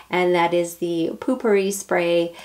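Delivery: ordinary delivery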